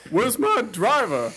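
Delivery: slow, gruff action-hero voice; Vin Diesel impression. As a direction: growl it like a tough-guy driver. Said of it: "what would Vin Diesel say?".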